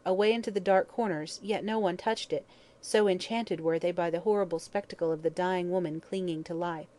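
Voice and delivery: ordinary delivery, nothing marked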